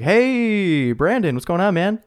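high, bright-toned mock of an eager caller; upbeat caller. Retold phone call, caller voiced.

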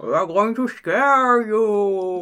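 slow, high, lisping through plastic fangs; fake fangs lisp. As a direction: talk as if wearing cheap fangs. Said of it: "I can try to mimic that".

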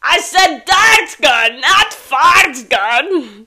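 slow, loud villain shout; Despicable Me villain voice. Acted. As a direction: yell slowly like a furious cartoon villain. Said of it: "I have no more Despicable Me".

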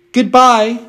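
high, dainty princess voice; a vain little china princess; cartoon princess. A narrator's reading.

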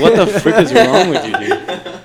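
high, loud laughing outrage; mock outraged shout. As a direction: play it like you can't believe this weirdo.

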